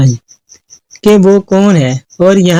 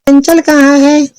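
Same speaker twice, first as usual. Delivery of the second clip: questioning mother; 9 semitones higher than usual. Acted. Mother asks daughter where her sister is, high questioning voice. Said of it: "इसकी माँ ने पूछा".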